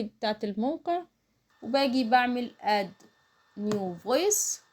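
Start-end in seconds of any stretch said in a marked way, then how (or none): none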